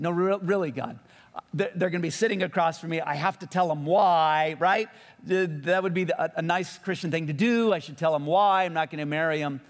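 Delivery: acted-out indignant objection; pleading protest. Arguing back at God in retold exchange.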